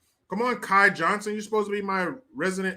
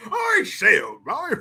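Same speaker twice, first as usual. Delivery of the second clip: exaggerated posh English; 3 semitones higher, 4 dB louder than usual.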